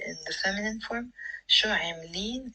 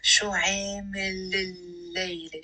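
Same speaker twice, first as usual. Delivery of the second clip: شو عامل الليلة stretched slowly, linked without a break; slow liaison demo. Shown as a demonstration. Teacher showing the liaison to learners, slow and linked. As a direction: draw out the l joining عامل to الليلة. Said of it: "notice how I'm saying it with a liaison".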